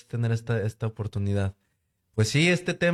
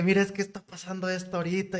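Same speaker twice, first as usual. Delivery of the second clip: higher, fast, breathless wife voice; excited wife; 8 semitones higher, faster than usual. Retold or imitated. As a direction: rush it like his thrilled wife. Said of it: "y así bien emocionada".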